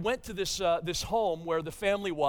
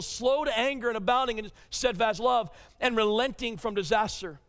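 louder, raised preaching read of Jonah's prayer; scripture reading; 5 semitones higher, 5 dB louder than usual. Acted. Pastor reading Jonah's prayer to the congregation, raised and preached. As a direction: proclaim the verses with a touch of Jonah's anger.

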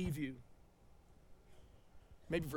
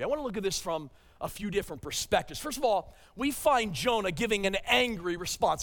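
ordinary delivery

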